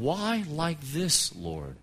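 soft, slow plea to God; hushed and searching; quiet questioning prayer. Retold or imitated. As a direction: ask it slowly, sinking almost to nothing. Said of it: "there's still this question".

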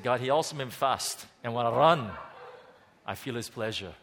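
half-hearted Scottish Eric Liddell voice; attempted Scottish accent. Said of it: "I gotta try it anyway".

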